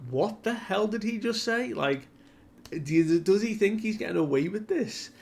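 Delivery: high-pitched incredulous retort; outraged disbelief. Retold or imitated. Everyone reacting to Summer's threat, in outraged disbelief. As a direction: imitate a crowd's indignant disbelief, pitch shooting up. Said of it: "they are getting furious and flustered".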